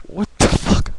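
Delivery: breathless, hushed mock reaction; whispered disbelief. Vlogger reacting to Venom meeting Sandman, whispered disbelief. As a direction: whisper it like you can't believe it. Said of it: "I'm like".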